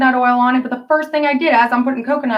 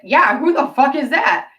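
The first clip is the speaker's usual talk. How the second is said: retold echo; 5 semitones higher than usual. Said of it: "And I said".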